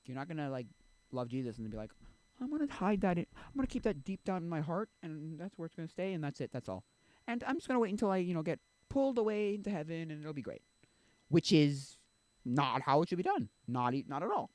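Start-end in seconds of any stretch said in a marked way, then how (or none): 2.4-10.6 higher, airy imitation of a complacent believer; lightly mocked secretive believer. Youth imitating a believer keeping faith private, lightly mocking. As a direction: lightly put on a naive believer's voice.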